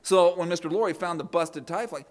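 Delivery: ordinary talk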